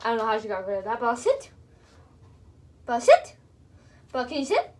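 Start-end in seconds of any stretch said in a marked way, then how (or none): 2.8-3.4 louder, higher, firm SIT; firm command. Real, not acted. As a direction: raise it to make her listen. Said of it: "repeated command louder".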